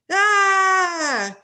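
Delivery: sudden loud scream of fright; a loud shriek as the rats hit; scared scream. Acted. Rats swarm the boy.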